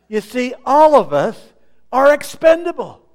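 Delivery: high, loud punchline; preaching emphasis. Real, not acted. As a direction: hammer the point home. Preaching climax.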